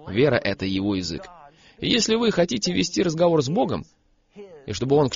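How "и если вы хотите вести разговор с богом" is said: normal talking voice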